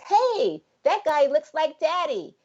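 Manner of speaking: put-on high, excited child's voice; voicing her little son. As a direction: mom voicing her five-year-old pointing at a man, high and eager. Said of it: "and he says".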